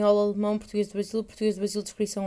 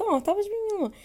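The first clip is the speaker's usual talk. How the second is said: much higher, cooing friend voice; pleased friend; 11 semitones higher, much faster than usual. Retold or imitated. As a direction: coo it like a happy friend. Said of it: "Ela ficou, tipo, quase contente".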